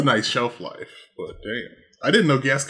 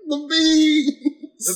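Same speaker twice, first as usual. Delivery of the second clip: mock anguish; 13 semitones higher than usual. Mock dying from bad beans.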